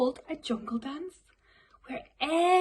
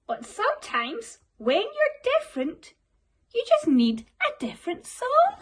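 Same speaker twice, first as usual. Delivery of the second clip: high little cricket voice, gently advising; kindly cricket; 9 semitones higher, 3 dB louder than usual. Acted. Cricket comforting sad Gerald, high kind voice. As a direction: lift him up like a wise small friend. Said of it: "coughed a cricket".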